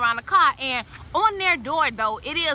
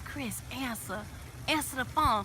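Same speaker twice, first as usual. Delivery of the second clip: urgent whisper; 3 semitones lower, 11 dB quieter than usual. Retold or imitated. Friend hushing to Chris on the phone, quietly urgent. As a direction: hiss it low so nobody hears.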